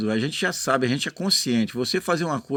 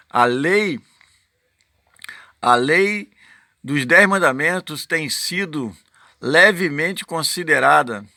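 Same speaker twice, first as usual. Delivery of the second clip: raised, loud proclaiming; preaching at full force; 4 semitones higher, 6 dB louder than usual. Acted. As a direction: declaim it like a pulpit reading. Proclaims the quoted law text.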